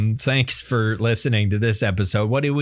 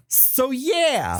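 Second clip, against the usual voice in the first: high, drawn New York twang; New York accent; 12 semitones higher than usual. Acted. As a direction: drop it like a New Yorker. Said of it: "I turned into somebody from New York".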